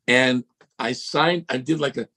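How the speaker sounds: ordinary delivery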